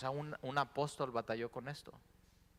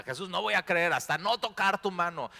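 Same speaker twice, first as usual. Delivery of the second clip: louder, higher, demanding proof; stubborn doubter; 7 semitones higher, 8 dB louder than usual. Retold or imitated. Thomas demanding to touch Jesus, loud and stubborn. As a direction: play Thomas digging in his heels.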